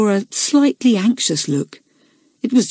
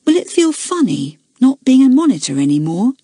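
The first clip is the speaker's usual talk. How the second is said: put-on curious girl; 7 semitones higher than usual. A narrator's reading. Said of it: "asked Kathleen".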